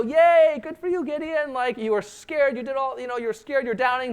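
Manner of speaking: mock cheer. Preacher cheering Gideon on for obeying, high and excited. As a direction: cheer him on like a proud fan.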